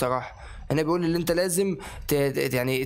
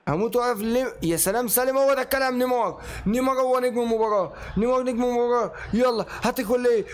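excited cheering; 7 semitones higher than usual. Real, not acted. Gamer hyping Neymar as man of the match, high excited cheering. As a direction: keep the hype up the whole way.

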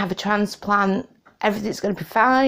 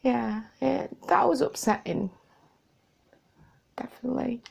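real tearful; 6 semitones higher than usual. Real, not acted. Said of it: "I'm getting emotional".

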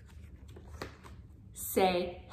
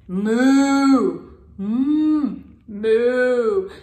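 a big, loud mooing cow, much louder; 7 dB louder, much slower than usual. Shown as a demonstration. Speech therapist modelling a cow sound for a toddler, loud moo. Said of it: "The cow says moo".

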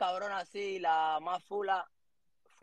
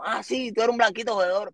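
retold angry insult; 6 semitones higher, 5 dB louder than usual. Old man insults the teen.

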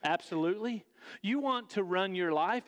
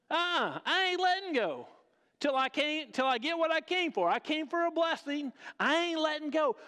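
stubborn Jacob; 7 semitones higher than usual. Retold or imitated. Jacob tells God he's not letting go, higher and defiant. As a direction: dig in like a wrestler who won't quit.

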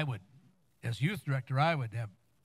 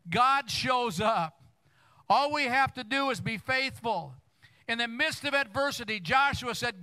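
higher, louder preacher's build to a climax; full-force preaching; 8 semitones higher, 3 dB louder than usual. Acted. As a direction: preach it, rising and pushing hard.

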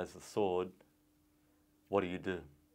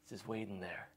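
soft, hushed and dreamy; hushed reverie about the pot of gold; mock dreamy hush; 12 dB quieter than usual. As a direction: tell it like a bedtime secret.

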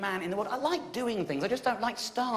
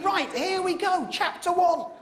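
raised, keen self-pep talk; 11 semitones higher, slower than usual. Retold or imitated.

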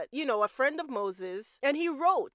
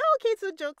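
mock brush-off; 10 semitones higher, 5 dB louder, much faster than usual. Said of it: "you might think".

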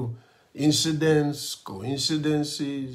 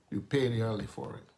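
soft repeat; 5 semitones lower, 10 dB quieter, faster than usual. Shown as a demonstration. Preacher repeats warning, much softer.

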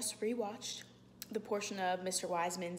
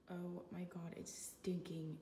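whisper; 3 semitones lower, 8 dB quieter than usual. Real, not acted. Reacting to garlic smell.

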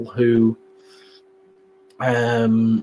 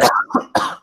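harsh cough on lodged popcorn; coughing fit, popcorn in the windpipe; choking cough. Real, not acted. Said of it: "While, while you're coughing".

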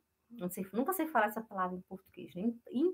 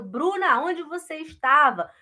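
calling out; 6 semitones higher, 8 dB louder than usual. Acted. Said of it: "a Bruna chega que eu falo".

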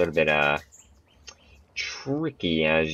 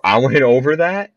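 surprised; 8 semitones higher, 7 dB louder than usual. Real, not acted. Surprised by result.

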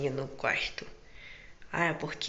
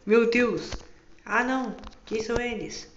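shocked; 6 semitones higher, 5 dB louder, slower than usual. Acted. Surprised by thieves appearing.

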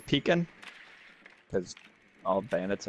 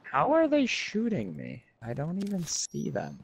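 surprised; 7 semitones higher than usual. Real, not acted. Shot by unseen enemy.

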